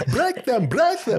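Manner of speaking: very high, loud mock Russian cry; Russian villain accent. Joining in on the wrestling coach bit, hammed-up Russian. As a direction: squeal the order in a Russian accent. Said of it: "Do the Russian accent".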